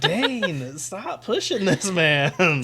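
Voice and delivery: high, drawn-out scolding through a laugh; mock exasperation. Host tells fictional Dane to stop pushing, mock exasperated. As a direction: groan it out slowly, half laughing.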